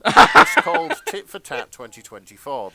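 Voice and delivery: laughing. Real, not acted. Laughing while naming the collection.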